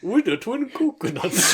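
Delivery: high, slow mocking voice with laughter; Twin Coconuts. Mimicking the duo to make friends laugh, slow and deliberate. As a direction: plod through it like a child reading aloud. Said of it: "That's how they speak".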